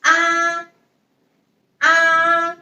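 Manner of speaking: ah held high and level; first tone ah. Shown as a demonstration. Teacher modelling a steady high first tone ah. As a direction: sing ah on one high flat pitch. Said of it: "A's pronunciation in pinyin is".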